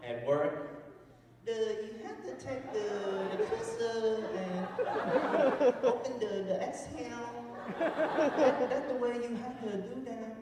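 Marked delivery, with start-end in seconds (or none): 1.5-7.3 put-on higher-pitched, halting Vietnamese accent. Engineer faking it at work, Vietnamese accent. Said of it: "I have to speak with an accent". 7.8-10.4 high-pitched put-on Vietnamese accent. Engineer instructing coworkers, mock Vietnamese accent. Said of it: "talking like that".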